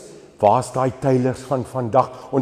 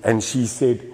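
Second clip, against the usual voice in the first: normal talking voice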